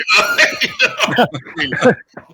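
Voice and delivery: a very high, loud burst of laughter; shrieking laugh. Real, not acted. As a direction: let out a squealing laugh. Laughs after his slip.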